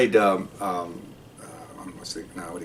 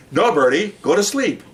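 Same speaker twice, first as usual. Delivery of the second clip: higher, insistent voice of a buddy; retold buddy; 6 semitones higher than usual. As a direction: imitate someone coaxing a bird, pitch swinging up. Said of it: "my buddy goes".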